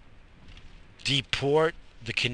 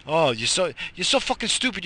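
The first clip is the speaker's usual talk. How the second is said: usual speaking voice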